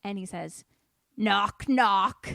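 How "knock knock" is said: very loud, drawn-out demon roar; hammed-up possessed-child voice; demonic possession voice. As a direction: bellow slowly like a territorial ghost. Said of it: "it has this voice".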